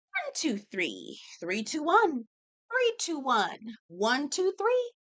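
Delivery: a mock self-soothing countdown, pitch swinging on each number; calming count. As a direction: breathe and count one two three to stay calm. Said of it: "I had to do my one, two".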